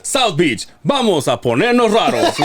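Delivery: high-pitched Spanish event promoter. Shown as a demonstration. Host redoing a Spanish promo he recorded, promoter voice. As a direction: hype the crowd like a club promoter. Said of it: "a promoter voice".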